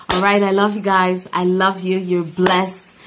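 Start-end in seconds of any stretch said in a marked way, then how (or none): none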